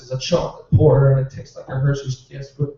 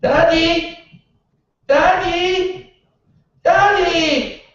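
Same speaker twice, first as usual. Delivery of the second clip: put-on high-pitched toddler calling out; shrill little kid yelling for dad from outside the door; imitating a toddler calling for his dad, much higher; child calling Daddy; 14 semitones higher, much slower than usual. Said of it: "I hear this".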